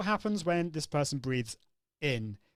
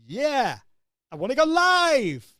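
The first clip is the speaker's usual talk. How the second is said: much higher, louder, slower, climbing on each bit; over-the-top rising pitch; 9 semitones higher, 9 dB louder, slower than usual. Shown as a demonstration. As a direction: go way up on every bit of the sentence. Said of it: "I went really over the top".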